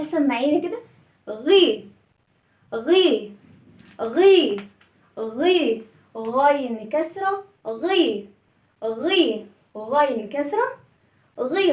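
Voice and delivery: غي said with a short kasra i, lips spread; broken gi sound. Shown as a demonstration. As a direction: clip غي short with an i vowel. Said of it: "هنكسر الصوت". (غي بالكسرة)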